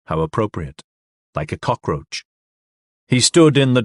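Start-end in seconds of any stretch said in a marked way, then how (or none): 0.1-2.2 low, soft contempt; scornful aside. A narrator's reading. Man sneering at drunk on floor, quiet contempt.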